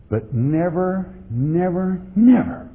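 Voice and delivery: stack the nevers until the third one rings out; building never. Real, not acted. Preacher warning his flock, building on never.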